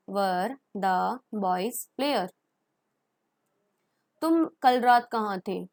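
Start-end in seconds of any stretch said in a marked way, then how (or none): 0.1-2.3 even slower repeat; slow model question. Shown as a demonstration. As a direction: drag it out for the class. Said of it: "model question". (और भी धीरे दोहराना)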